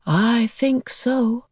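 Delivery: drawn-out alien reply; thoughtful beast. A narrator's reading. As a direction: answer slowly, considering. Beast answers the father.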